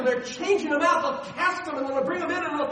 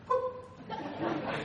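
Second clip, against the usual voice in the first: soft high "boop"; tiny, delicate flick noise; little high pop, softly; dainty sound effect; 5 semitones higher, 8 dB quieter than usual. Retold or imitated. Imitates flicking fish off hook.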